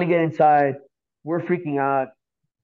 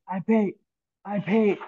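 acted winded plea, higher pitched; out of breath; 6 semitones higher, slower than usual. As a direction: get it out in short winded bursts. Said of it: "I'm, like, out of breath".

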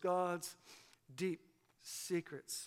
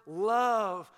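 love punched loud and falling; stress on love; 6 dB louder than usual. Shown as a demonstration. Pastor stressing to the congregation the key word love. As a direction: hit the word love hard, then drop it. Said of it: "Paul puts the emphasis on that word".

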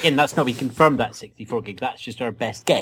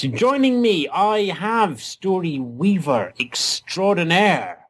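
master storyteller; 6 semitones higher than usual. Acted. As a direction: welcome listeners like a grand old storyteller. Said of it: "your master storyteller".

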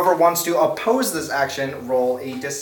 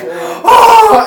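much higher and louder, with a laugh; crowd gasp; 11 dB louder than usual. Acted. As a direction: gasp in amazement. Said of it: "and everyone's like".

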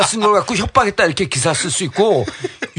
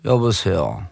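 mock a very low, lowered phone hello; affected low voice; 11 semitones lower, 3 dB quieter, much slower than usual. Reporter answering the politician's phone call, affected low voice. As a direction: drop the voice right down, imitating the reporter. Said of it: "그래 딱 깔아요, 목소리를".

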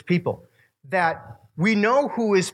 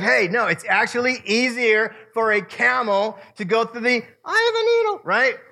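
high, playful Jesus teasing with a dad joke; jokey dad joke; 7 semitones higher than usual. Retold or imitated. Jesus teasing the disciples, jokey dad-joke voice. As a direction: throw in the joke with a grin. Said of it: "jokey dad joke".

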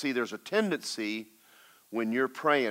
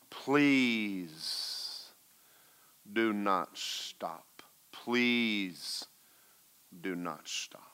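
very slow, drawn-out plea fading to a whisper; pleading Holy Spirit; 4 dB quieter, much slower than usual. Retold or imitated. Holy Spirit urging intercession to continue, slow hushed pleading. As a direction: beg softly, stretching and spacing each word. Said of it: "And the Holy Spirit said".

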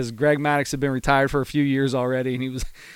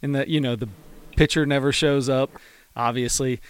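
ordinary talk, nothing marked

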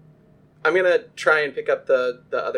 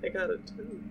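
defeated whisper; 2 semitones higher, 15 dB quieter than usual. Real, not acted. Second bad roll.

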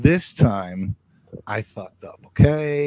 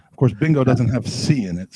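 ordinary talk, nothing marked

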